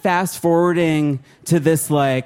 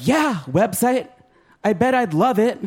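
sarcastic comeback; 7 semitones higher than usual. Retold or imitated. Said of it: "And it's like".

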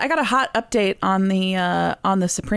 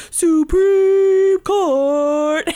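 louder, higher and very slow, like an announcer; grand announcement; 8 semitones higher, 5 dB louder, much slower than usual. Acted. Plugging the Patreon tier to listeners, grand announcer voice. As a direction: present the tier name like a grand title.

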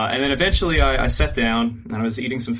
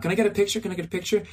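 higher-pitched pestering fan voice; imitated eager fans; 7 semitones higher, much faster than usual. Crowd asking the fleeing cosplayer, imitated higher pleading. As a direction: mimic fans begging, pitched up.

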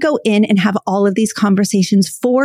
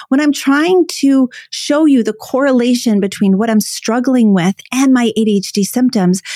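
ordinary talk, nothing marked

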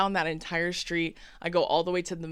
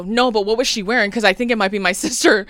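louder, urgent question to the cop; anxious raised voice; urgent louder reply; 3 semitones higher, 9 dB louder, faster than usual. Retold or imitated. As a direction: push it out louder and faster. Frantic answer to cop.

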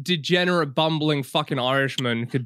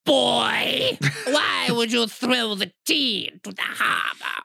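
much higher shouted tough-guy voice, slow; character bit; 6 semitones higher, slower than usual. Acted. Continuing the Boston bit, a high, slow mock shout. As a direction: bark it out as a hammed-up old Bostonian. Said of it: "I'm not done with that bit".

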